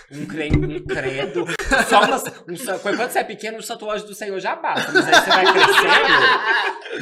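laughing through the words, voice climbing high; can barely get it out for laughing. Real, not acted. Rindo ao lembrar das rezas. (rindo muito)

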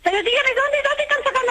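high, exasperated demand; real worried impatience; insistent questioning. Real, not acted. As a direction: press them urgently for a straight answer. Presses callers about the stolen water.

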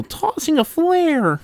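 very high, drawn-out creature squeal; squeaky alien yell, stretched out; yelling grunt. A narrator's reading. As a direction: call it out as a shrill little grunt. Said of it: "hear an unseen grunt yell".

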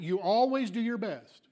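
emphatic challenge on "always"; challenging, with "always" pushed up; pointed challenge. Shown as a demonstration. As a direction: throw the claim back, leaning on "always". Challenges the congregant's claim.

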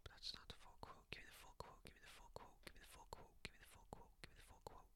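hushed repeated demand; whisper. Real, not acted. Streamer whispering at the screen for the full quote. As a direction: whisper it over and over, impatient.

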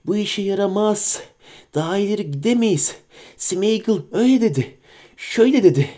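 sly creature whining at its master; Gollum voice. Acted. Gollum speaks at length.

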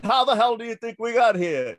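loud, high retort; exasperated shout. Retold or imitated. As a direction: snap it back, fed up. Friend's exasperated retort retold.

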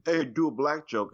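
a half-hearted retold yell; mild mock yell. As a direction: imitate a heckler calling out. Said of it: "just literally yells at him".

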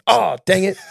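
high-pitched comic "oops" exclamation; mock mild annoyance. Parent imitated remembering what got left behind, mock annoyed. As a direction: play remembering a trivial forgotten item.

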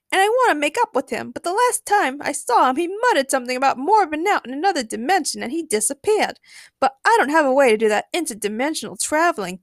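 high, lively character voice; Harley, wistful. A narrator's reading. Harley explains her falling out with Joker, plaintively.

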